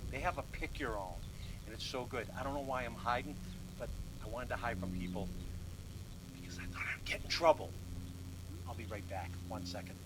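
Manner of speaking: soft, low confiding voice; furtive hush. Real, not acted. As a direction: keep it down as if hiding from people. Hiding while sneaking a picked strawberry.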